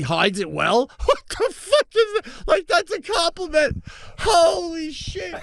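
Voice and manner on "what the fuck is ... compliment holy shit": very high, squeaky disbelief with laughter; real laughter breaking into high-pitched dismay; incredulous laughing. Real, not acted. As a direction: crack up at your own blunder, pitch soaring. Laughing incredulously at his own blunder.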